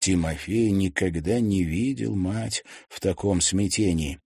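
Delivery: usual speaking voice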